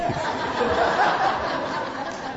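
high airy chuckle; breathy laugh. Real, not acted. Preacher joking about being a cop, airy laugh. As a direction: let a silent laugh out.

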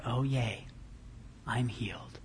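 soft, whispered, barely bothered; flat unexcited mumble. Pretend. Healed man muttering, mock underwhelmed whisper. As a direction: murmur it like nothing happened. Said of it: "You would probably respond in a loud, victorious sort of".

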